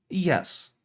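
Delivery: usual speaking voice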